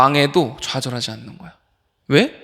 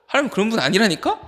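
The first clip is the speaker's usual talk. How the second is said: higher-pitched, insistent reminder; high insistence; 6 semitones higher than usual. Real, not acted. Preacher telling a youth God is not like that, high insistence. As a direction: press the point in a raised pitch.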